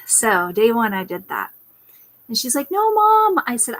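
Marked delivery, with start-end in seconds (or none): 2.7-3.4 put-on high, loud childish whine; mock kid pleading with mom; daughter's protest. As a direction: whine it like a small girl refusing. Daughter protesting in retold story.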